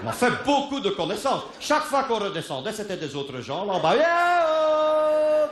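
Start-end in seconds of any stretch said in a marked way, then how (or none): 4.0-5.5 high called-out hail; sing-song call from above. Retold or imitated. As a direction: hail the people below. Calling out from the ski lift.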